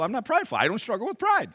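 hammed-up defensive churchgoer; mock indignant denial. Churchgoer denying pride to the preacher, mock indignant.

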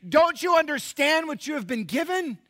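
high, loud indignant cry; imagined Moses shouting. Retold or imitated. Moses rebuking today's church, shouting high. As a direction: Moses scolding the church from the past. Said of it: "he would say".

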